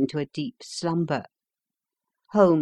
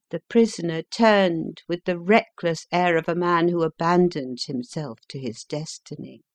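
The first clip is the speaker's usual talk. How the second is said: ordinary delivery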